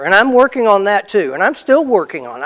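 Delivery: ordinary talk, nothing marked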